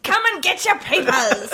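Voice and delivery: high street-seller's call; old-timey newsboy. Pretend. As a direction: hawk papers like an old-time newsboy. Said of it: "talk in an old-timey voice".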